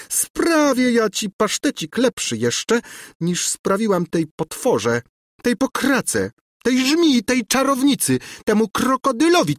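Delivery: high, spiteful list of insults; venomous high rant, slowing on the last; screeching tirade. A narrator's reading. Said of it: "skrzeczała za nim Gburja Furia".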